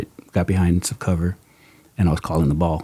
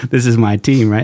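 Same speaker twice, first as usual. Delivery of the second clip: mock territorial; 4 semitones higher, 7 dB louder than usual. Voicing angry George's thoughts.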